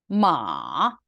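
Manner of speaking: 마 dipping low, then rising; third tone. Shown as a demonstration. Teacher showing students the third tone, dipping 마. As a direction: dip down on 마 and swing back up. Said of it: "삼 성, 사 성".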